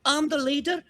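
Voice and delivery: a tiny self-appointed leader announcing himself; proud orc kid leader. Acted. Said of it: "he's like, he's like".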